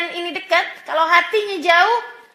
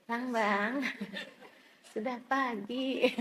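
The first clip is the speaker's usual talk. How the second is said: very soft, slow whispered wake-up call; 3 semitones lower, 11 dB quieter, slower than usual. Retold or imitated. New bride softly waking her husband, whispering. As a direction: murmur it gently close to his ear. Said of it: "Pelan banget suaranya, bisik-bisik".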